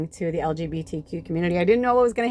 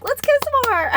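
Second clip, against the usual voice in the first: high, loud excited squeal; giddy friend; 7 dB louder than usual. Retold or imitated. Friend begs for more kissing, giddy. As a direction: imitate a friend squealing with delight.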